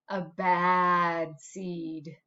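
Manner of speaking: low, slow, spooky whisper-like repeat; ominous low echo. Acted. The seed telling the class he is bad, low and slow. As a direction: drop the voice low and drag it out.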